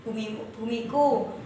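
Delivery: "bumiku" said low and soft, sorrowful; sad poem reading. Shown as a demonstration. Teacher shows the class a sad expression for "bumiku". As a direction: read "bumiku" with a touch of sadness. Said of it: "Jadi agak sedih".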